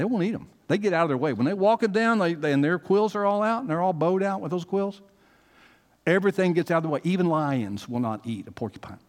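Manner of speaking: high, loud pulpit preaching, building; rising Southern pulpit fervor; full-force preaching. Acted. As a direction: preach it hard, lifting the congregation. Preaching the porcupine picture.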